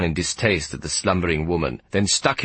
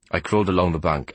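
usual speaking voice

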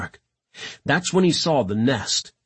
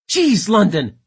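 loud, high, irritated outburst; exasperated snap; 7 semitones higher, 6 dB louder than usual. A narrator's reading. Gilmore after London's missed shots, snapping. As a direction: snap at a crewmate who keeps missing. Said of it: "Gilmore snapped".

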